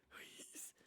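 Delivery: faint, soft whispered please; hushed plea. Acted. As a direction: beg with no voice left. Weak Stone pleads.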